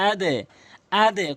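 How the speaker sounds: আদে with a short "e" bounce on the d; downward qalqalah. Shown as a demonstration. Teacher modelling dal for learners, downward bounce on আদে. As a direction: push the echo of আদে down to "e". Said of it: "নিচের দিকে sound করব".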